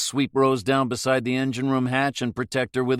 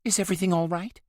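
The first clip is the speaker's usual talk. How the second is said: higher, anxious question; 9 semitones higher, faster than usual. A narrator's reading. Rose asking from the doorway, anxiously. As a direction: worry in it. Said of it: "Rose said anxiously".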